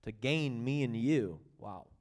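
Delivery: ordinary talk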